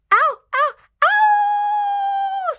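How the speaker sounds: very high, loud yelps of pain; pained screaming. Acted. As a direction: yelp as he's scalded. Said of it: "screamed the wolf".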